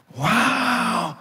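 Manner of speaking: long, high swooping wow; amazed wow. Acted. Pastor sees gospel in the custom, amazed wow. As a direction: draw out the exclamation in wonder.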